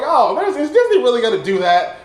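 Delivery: loud, high incredulous question; mock outraged disbelief; disbelieving reaction. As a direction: question it as if you can't believe it. Acts out his disbelief while watching.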